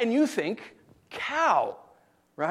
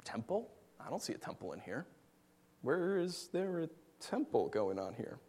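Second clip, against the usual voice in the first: soft, bewildered questioning; quietly baffled listener looking around; puzzled murmur; 4 semitones lower, 15 dB quieter than usual. Acted. Puzzled congregation, acted.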